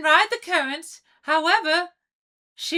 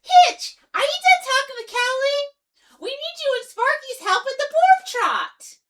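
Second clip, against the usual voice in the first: calling out; 7 semitones higher than usual. Acted. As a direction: shout for Hitch to come over. Izzy calling Hitch away from call.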